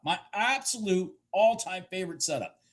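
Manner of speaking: ordinary talk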